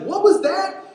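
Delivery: high-pitched, loud shouted question; straining to be heard over a gale; calling over storm. Acted. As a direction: yell it as if you can't hear. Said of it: "it's so loud".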